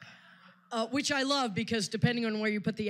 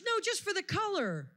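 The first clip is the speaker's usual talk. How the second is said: much higher, lightly voiced fussy mother; a hint of a prim mother coaxing; imitating mother; 11 semitones higher than usual. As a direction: chirp it like a mom nudging her daughter. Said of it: "And then she says".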